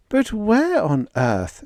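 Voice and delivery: high, slow puzzled question; bewildered. Acted. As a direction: ask in confusion. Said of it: "said Vera, bewildered".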